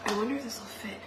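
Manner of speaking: soft unvoiced whisper to herself. Real, not acted. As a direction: murmur it privately while trying the fit. Testing a fit, focused.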